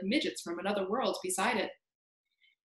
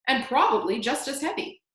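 ordinary delivery

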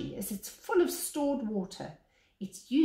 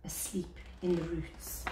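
soft whisper, so as not to wake them; 4 semitones lower, 7 dB quieter than usual. Acted. Telling of the sleeping young ones, a hushed whisper. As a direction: breathe it, barely voiced.

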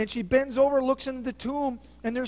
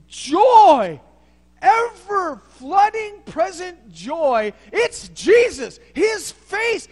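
much louder and higher, shouting with joy; joyful shouting preaching; 9 semitones higher, 11 dB louder, slower than usual. Acted. Preacher proclaiming the risen Jesus, shouting with joy. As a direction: explode with joy.

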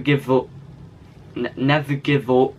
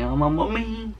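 high whiny mock sob; mock crying voice; 10 semitones higher than usual. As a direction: whimper it like a crying kid. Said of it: "your crying voice".

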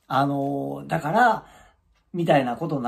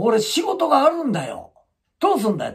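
higher, louder mock demand; imitating an angry man; 9 semitones higher, 4 dB louder than usual. Man demanding to be seen, mock angry. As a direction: insist like a pushy patient.

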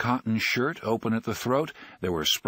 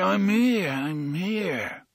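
sleepy slur; 9 semitones higher, much slower than usual. Acted. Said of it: "slurry, muddy voice of a man who is still asleep".

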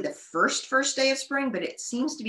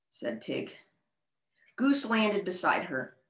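lively storybook read-aloud; reading to children. A narrator's reading. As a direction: read it brightly to young kids. Reads a picture book aloud.

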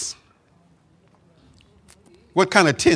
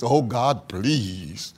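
softer, lower, whining plea; pleading imitation, lower and quieter; mock begging; 5 semitones lower, 7 dB quieter, much slower than usual. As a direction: mimic a beggar's pleading. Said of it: "this begging business".

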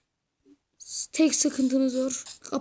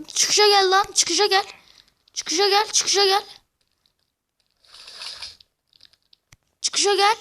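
loud taunting challenge; 5 semitones higher, 4 dB louder than usual. Acted. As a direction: shout the dare, over and over. Taunting enemies to fight outside.

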